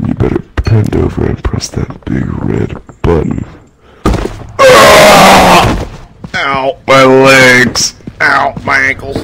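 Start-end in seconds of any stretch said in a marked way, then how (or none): 0.1-3.4 breathy sultry whisper; seductive whisper. Acted. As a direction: whisper it like a tease. Same line repeated, whispered.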